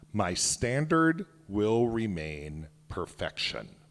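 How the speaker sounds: "my standard will remain perfection" slowed and pressed, each word weighted; emphasised line. Shown as a demonstration. Author stresses the sixth line of the creed, slow and weighted. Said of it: "I'll put some emphasis on it".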